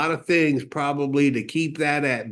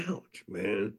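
low, much softer aside; retold sting; 4 semitones lower, 10 dB quieter than usual. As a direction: act out being quietly stung. Stung by Ben's blunt interruption.

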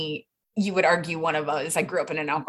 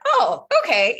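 high, louder mock-startled "oh, okay"; retold surprise; 11 semitones higher, 6 dB louder, slower than usual. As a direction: act caught off guard, pitched up. Retold reaction to professor's confession.

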